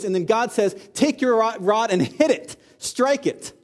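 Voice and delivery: high, loud, punched pulpit delivery; impassioned preaching. Acted. Preacher on God's command to strike his presence, impassioned preaching. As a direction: hammer each phrase home with awe.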